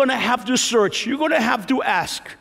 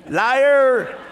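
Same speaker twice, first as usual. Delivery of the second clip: louder, higher teasing shout; mock accusation; 4 semitones higher, 4 dB louder than usual. Real, not acted. Preacher teasing the congregation, mock shout of liar. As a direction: call them out playfully.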